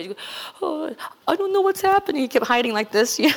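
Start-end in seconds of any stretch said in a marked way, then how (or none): 0.6-2.2 high, tearful mock voice of a young man; imitating Freddie crying. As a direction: act out a bewildered man fighting tears.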